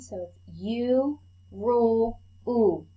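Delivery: "U", "rule", "oo" drawn out slowly as the "oo" sound; teacher showing the other U sound; second U sound demo. Shown as a demonstration. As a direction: model "oo" slowly for the class to echo. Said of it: "U has another sound".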